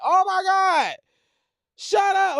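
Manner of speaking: high ecstatic squeal. Retold or imitated. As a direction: squeal with delight. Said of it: "something like ecstatic".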